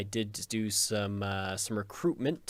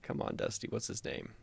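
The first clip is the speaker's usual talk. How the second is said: whispering; 4 semitones lower, 7 dB quieter than usual. Real, not acted. Muttering to himself, forgetting name.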